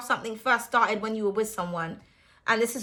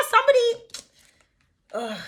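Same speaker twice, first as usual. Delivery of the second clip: exasperated groan; 10 semitones higher, much slower than usual. Real, not acted. Reacting to Ace of Wands.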